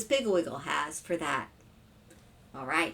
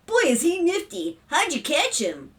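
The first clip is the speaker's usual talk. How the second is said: put-on higher boyish voice; impressed boy; 8 semitones higher, 3 dB louder than usual. A narrator's reading. Brother admires the frog, impressed boy. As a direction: marvel like an excited kid.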